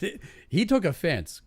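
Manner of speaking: ordinary delivery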